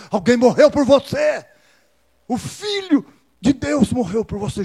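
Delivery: loud, high proclamation, slowing down; preacher crying out to the congregation, fervent; fervent preaching. Acted. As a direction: drive the truth home to the crowd.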